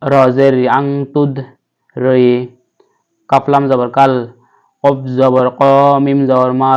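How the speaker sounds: ordinary delivery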